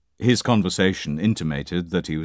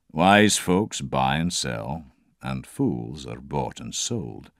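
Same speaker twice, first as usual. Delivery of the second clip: slow, measured Scots drover; slow Scottish proverb; 3 semitones lower, slower than usual. Acted. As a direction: deliver it slowly like a proverb. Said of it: "slow, pedantic mode of expression".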